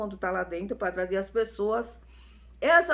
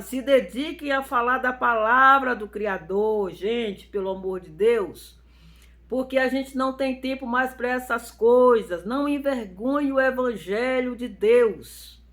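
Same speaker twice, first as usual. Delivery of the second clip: preaching; 2 semitones higher, 6 dB louder than usual. Real, not acted. Exhorting believers with rising force.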